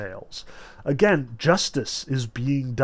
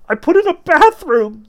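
very high, loud, squeaky terrified voice; terrified cry; 11 dB louder than usual. A narrator's reading. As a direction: squeal it out in panic. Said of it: "the bloodied Mogarich cried".

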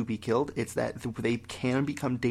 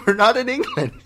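laughing protest; 10 semitones higher, 10 dB louder than usual. Real, not acted. Laughing playful argument.

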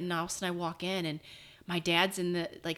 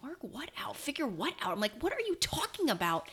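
confused; 7 semitones higher than usual. Retold or imitated.

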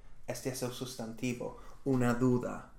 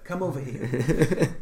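laughing beckon; 3 semitones higher, 7 dB louder, slower than usual. Shown as a demonstration. Playful beckoning demo with laugh.

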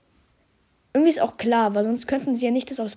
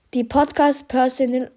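ordinary delivery

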